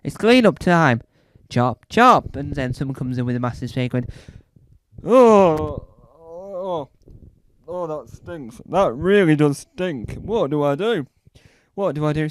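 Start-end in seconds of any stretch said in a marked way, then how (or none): 0.0-2.3 bossy order. Acted. Repeating the clean-up order. 5.0-12.3 disgusted cleaner. Acted. Cleaner reacting to the stink.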